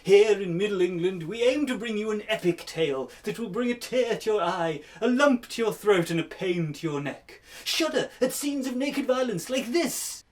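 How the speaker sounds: hammy announcer. Acted. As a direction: announce it like an old TV host. Mock TV show announcer intro.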